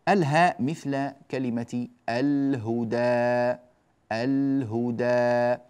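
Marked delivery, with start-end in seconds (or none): 2.1-5.6 الهدى with ل held before ه; slow الهدى, long open ending; clear lam demo. Shown as a demonstration. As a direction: model الهدى with the ل distinctly sounded. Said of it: "فهذه الأمثلة على لام ال".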